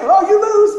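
high, loud gloating; playful sibling gloat; teasing. Retold or imitated. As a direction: taunt the little brother. Sister teasing in game.

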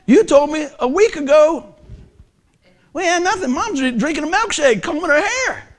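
loud, high retort to the doctors; retold outburst. Retelling his angry reply to doctors.

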